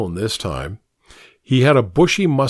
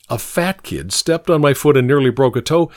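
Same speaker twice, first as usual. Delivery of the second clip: ordinary delivery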